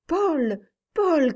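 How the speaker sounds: high, loud cry of the name. A narrator's reading. Mother bursting in on her son, crying his name. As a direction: call out to him in alarm. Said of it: "cria-t-elle".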